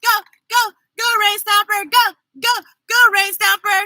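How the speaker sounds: high, excited chant of go, go; cheering. Real, not acted. Reader leading the kids in a cheer, high excited chant. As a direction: whoop and chant for the rain stomper. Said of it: "let's cheer for everyone".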